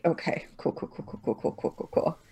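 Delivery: rapid whispered repetition; muttered to self. Real, not acted. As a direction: mumble it under your breath. Muttering while doing dice math.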